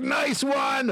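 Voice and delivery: high-pitched mock congratulation; gleeful retold jeer; imitated laughing villain. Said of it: "he's laughing".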